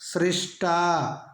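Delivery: सृष्टा very slow, स joined to र; very slow teacher demo of सृष्टा; careful pronunciation. Shown as a demonstration. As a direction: stretch सृष्टा out for the class. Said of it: "शुद्ध होता है स में र".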